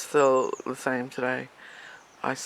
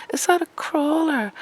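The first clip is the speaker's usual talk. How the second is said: nosy neighbour; 11 semitones higher, 5 dB louder than usual. Retold or imitated.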